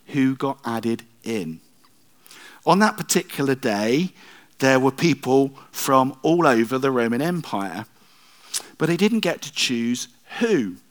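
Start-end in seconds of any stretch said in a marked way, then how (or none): none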